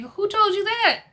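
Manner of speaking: high and loud mock demand; retold outraged question; indignant question. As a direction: shoot it up high, imitating Teresa. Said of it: "And Teresa's like".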